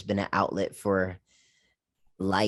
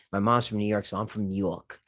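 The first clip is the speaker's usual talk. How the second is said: normal talking voice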